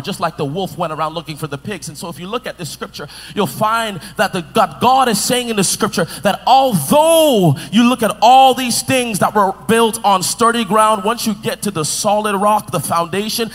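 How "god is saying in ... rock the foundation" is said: louder, slow, rising preacher's build; full-force sermon climax; preaching. Acted. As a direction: drive it home to the congregation.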